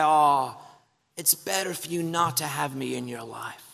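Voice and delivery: lightly voiced movie lover, sweetly letting someone down; lame romcom breakup line. Lover ending a romance, mock tender breakup voice. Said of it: "lame things said in the breakup scene".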